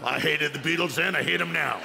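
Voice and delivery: a hint of a grumpy old man; lightly voiced sour old-timer; mild grumble of a cranky audience member. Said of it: "except this one man in the aisle".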